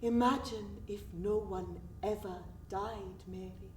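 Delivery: low and soft, fading away; hushed wonder. Acted. Friend musing to Mary on living forever, hushed. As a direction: muse softly, letting the name fade out. Wistful musing on never dying.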